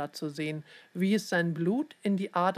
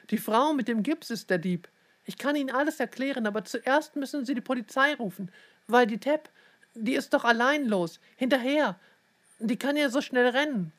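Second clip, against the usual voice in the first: higher-pitched, breakneck explaining; urgent pleading; 6 semitones higher than usual. A narrator's reading. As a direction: blurt it out in a pressing rush. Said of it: "drängte Leon".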